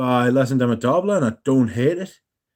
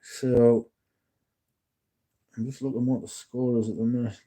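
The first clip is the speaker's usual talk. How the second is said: usual speaking voice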